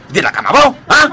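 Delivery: usual speaking voice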